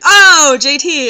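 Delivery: sudden loud, high catch of realization; a boss catching herself, loud and surprised. Retold or imitated. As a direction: blurt it like the penny just dropped. Supervisor realizes correct name.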